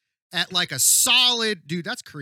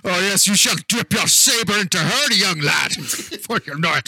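put-on Scottish Sean Connery accent, louder; Sean Connery impression; 3 dB louder than usual. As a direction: do a hammy Sean Connery brogue. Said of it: "That was very Sean Connery".

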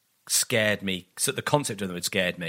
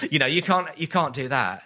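ordinary talk

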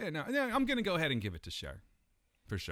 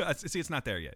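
normal talking voice

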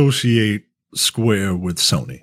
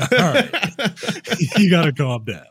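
laughing; 9 semitones higher, slower than usual. Real, not acted. Laughing at son's George impression.

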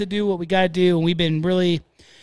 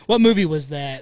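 ordinary delivery

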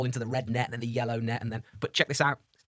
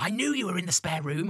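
higher-pitched imitation of an excited kid; mock childish discovery, pitched up; lightly voiced excited child; 6 semitones higher than usual. Daughter finding the house guest, lightly imitated excited child.

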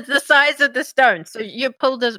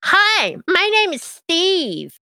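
higher, chipper little imp; 8 semitones higher, slower than usual. Acted. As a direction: play a polite little imp. GM voices imp Steve.

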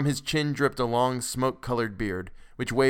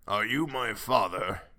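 put-on slow old man's croak; cracked ancient voice; slower than usual. A narrator's reading. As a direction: ask it as a very old man. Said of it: "in a cracked and ancient voice".

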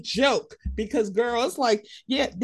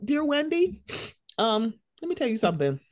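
normal talking voice

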